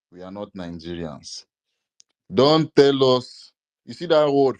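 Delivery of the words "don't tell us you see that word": slow, loud and firm; loud scolding. Real, not acted. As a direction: lay down the law. Scolding the caller.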